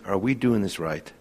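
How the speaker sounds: near-whispered self-questioning; a small doubting voice deep inside; hushed inner doubt. Retold or imitated. Brothers' conscience asking itself, hushed and uneasy.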